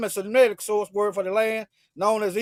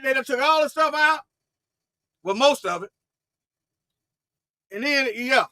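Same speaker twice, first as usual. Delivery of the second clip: ordinary talk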